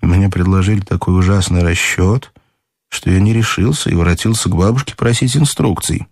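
ordinary delivery, nothing marked

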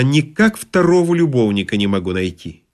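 plain reading voice

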